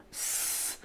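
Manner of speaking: S said as a long hiss, sss; letter sound. Shown as a demonstration. Teacher shows pupil the end of the name, sounding S as a hiss. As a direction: hold S as a pure consonant, no vowel. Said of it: "qui nous donne le son S".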